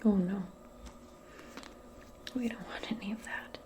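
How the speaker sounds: normal talking voice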